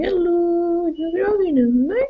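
high sneering challenge; a high mocking repeat; mock tough guy. Repeating his line to her listeners, high mocking sneer.

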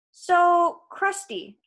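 loud high opener, then dropping; forced light tone. A narrator's reading. Percy buttering up the giant, lightly casual. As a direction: keep it casual despite the danger. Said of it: "trying to keep my voice light".

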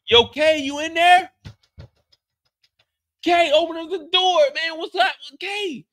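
loud, high shout through a door; calling out. Acted. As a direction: yell for a friend to open up, high-pitched.

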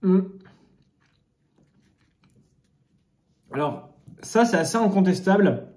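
talking while chewing food; muffled by food in mouth; mouth full, chewing. Real, not acted. Seen 1: Bites at -7.0, closed-mouth chewing through +1.9, then talks while chewing.

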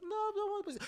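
put-on very high, loud child's wail; squeaky mock refusal; imitated kid. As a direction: mimic a protesting little boy. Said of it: "And the kid's like".

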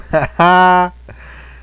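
high, loud burst of laughter. Real, not acted. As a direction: break into a big laugh. Laughs at his own joke.

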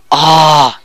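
ordinary delivery

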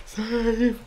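very high, rising pleading squeal; desperate squeal. Real, not acted. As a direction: beg the game in a panic, voice shooting up. Begging the game to save.

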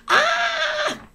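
very high, strained wail; anguished cry. Acted. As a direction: let out a piercing cry of despair. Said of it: "he spoke out and said".

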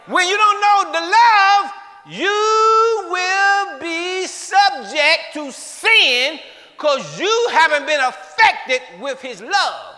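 high, loud and slow preacher's cry; slow preaching shout. Acted. Preacher slowly crying out to the congregation. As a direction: draw the words out over the crowd.

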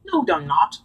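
short cross denial. Acted. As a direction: snap back a little crossly. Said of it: "said James crossly".

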